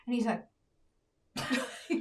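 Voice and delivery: ordinary talk, nothing marked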